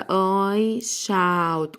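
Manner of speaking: 'shout' with OU as a drawn-out 'ow'; teacher stretching 'shout' for learners; modelled pronunciation. Shown as a demonstration. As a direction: slowly model 'I shout' with an open 'ow'. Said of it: "ال O واليو بننطقها آو".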